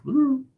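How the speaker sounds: tiny, very high "ooh"; little bird sound. Retold or imitated. Speaker likens her frail grandmother to a little bird, high bird-like coo. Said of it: "you know, a little bird".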